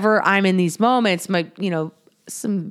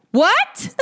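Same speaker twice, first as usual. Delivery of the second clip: much higher, squeaky incredulous what; stunned what; 12 semitones higher than usual. Retold or imitated. To her best friend's calm advice, squeaky disbelief. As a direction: sound floored by the answer.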